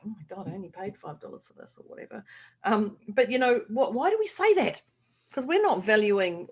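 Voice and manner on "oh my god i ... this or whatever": quick, much softer throwaway brush-off; dismissive mumble. Retold or imitated. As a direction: mumble it away as if the compliment is nothing. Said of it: "And you're like".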